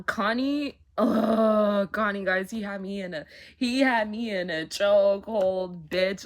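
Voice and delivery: loud, swooning fangirl outburst; excited gushing. Real, not acted. Fan gushing to viewers over a character, loud and swooning. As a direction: shout his name like he owns you.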